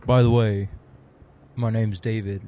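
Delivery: usual speaking voice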